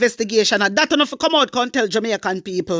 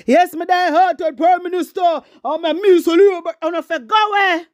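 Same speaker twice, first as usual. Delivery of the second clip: mock die-hearted supporter; 5 semitones higher than usual.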